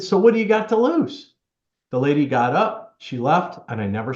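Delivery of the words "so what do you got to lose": much higher, a light upbeat challenge; encouraging punchline. Retold or imitated. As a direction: nudge her with a playful dare. Quoting own punchline advice.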